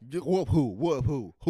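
loud, incredulous comeback; mock outraged challenge. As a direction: fire it back loudly, spoiling for a fight. Waiter challenges the boast in skit.

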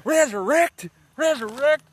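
high, loud repeated preacher yell; imitating a desperate faith healer, full voice; mock conviction. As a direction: shout the command with hammed-up conviction. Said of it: "A little more conviction".

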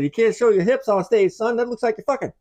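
high, loud mock-scolding authority; scolding censor. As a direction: squawk it like an outraged old censor. Mocking fifties censors scolding [name].

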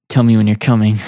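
low, creepy weasel voice. Retold or imitated. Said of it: "you hear him creepily".

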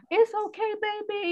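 put-on high little-girl voice comforting mom; imitating her toddler. Little daughter comforts crying mom, high childlike voice. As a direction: play the three-year-old soothing mommy. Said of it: "she'll be like".

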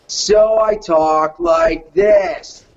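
very slow, zenned-out dude voice; chilled-out skater dude. Shown as a demonstration. Showing his audition voice for a skater, chilled out. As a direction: stretch it out like a spaced-out skater. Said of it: "He's a chilled out skater dude".

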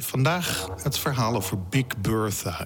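normal talking voice

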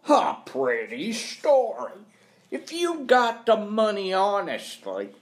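put-on high, forceful, scornful old employer; scornful accuser. Employer doubts caretaker's honest money, scornful. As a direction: pitch it up and pour on disbelief.

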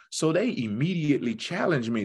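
ordinary delivery

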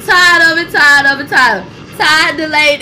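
loud, fed-up cry of complaint; fed-up angry crying. Real, not acted. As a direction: wail how tired you are.

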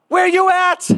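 high-pitched, frantic phone cry; acted-out freaked-out yelp, pitch shooting up; retold panicked demand. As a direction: blurt it out in mock panic. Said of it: "Not like, Hello, how you doing?".

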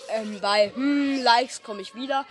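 normal talking voice

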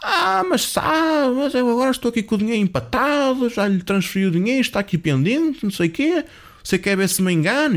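hammed-up exasperated caller; mock agitation. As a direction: stretch the opening, then protest high and indignant. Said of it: "e o homem também exaltado".

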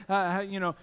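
ordinary delivery

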